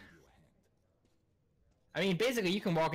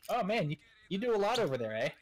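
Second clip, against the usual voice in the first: put-on a light Canadian accent with a higher pitch; lightly imitated Canadian coworker; 6 semitones higher than usual. Phone colleague praising his presentation, in a light Canadian accent. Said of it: "I didn't know you were Canadian".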